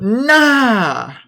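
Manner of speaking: high, whiny fake sob; mock crying. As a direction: play at crying over chat.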